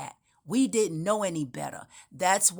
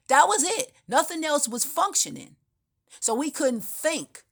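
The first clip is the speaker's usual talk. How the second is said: high excited insistence on the point; emphatic exclamation; 9 semitones higher than usual. Real, not acted. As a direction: drive the point home high. Emphatic point about the brain.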